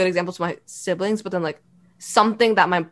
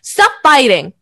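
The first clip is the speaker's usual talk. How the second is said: loud, raised-pitch mom telling-off; imitated scolding; 5 semitones higher, 9 dB louder, slower than usual. Imitating mom scolding her in the car, loud telling-off. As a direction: mimic a mother's sharp order, loud.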